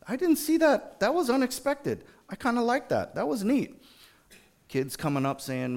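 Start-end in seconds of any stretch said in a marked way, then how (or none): 0.1-3.8 high-pitched, pleased amazement; pleasant surprise. Acted. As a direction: marvel in a high little voice at something unexpected. Acting out delighted surprise.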